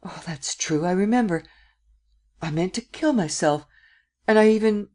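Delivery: memory suddenly dawning, pitch climbing; sudden recollection. A narrator's reading. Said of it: "And suddenly recollecting".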